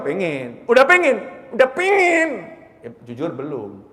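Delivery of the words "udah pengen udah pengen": loud, high, insistent cry; fervent declaration. Acted. Preacher insisting we already want paradise, fervent cry. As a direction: belt it out, climbing higher on the repeat.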